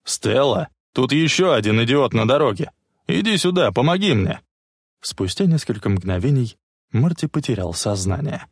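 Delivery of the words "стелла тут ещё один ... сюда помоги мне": raised, urgent call; shouting. A narrator's reading. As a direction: shout to his wife for help. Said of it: "закричал".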